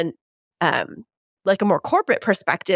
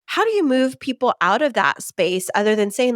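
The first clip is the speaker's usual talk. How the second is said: ordinary talk, nothing marked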